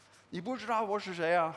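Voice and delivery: fast, mock indignant Chinese; mock indignant boss. Pastor mocking the hurt ego, mock indignant. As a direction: puff up like an offended big shot. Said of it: "You know".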